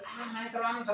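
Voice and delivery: high whiny student plea. Retold or imitated. Students whining to the teacher before notebooks, high whine. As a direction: whine like a pupil begging.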